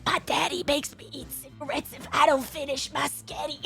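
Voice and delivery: put-on soft rough little-kid voice; a croaky child whining; raspy kid voice. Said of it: "Mim sounds like the".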